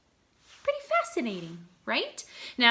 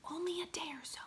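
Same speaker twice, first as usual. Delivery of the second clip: a hushed aside, just breathed out; whisper; 8 semitones higher, 12 dB quieter, faster than usual. Real, not acted. Teacher marveling to her class, whispered aside. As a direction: lean in and whisper it.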